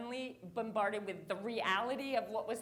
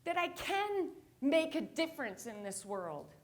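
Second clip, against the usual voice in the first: ordinary talk, nothing marked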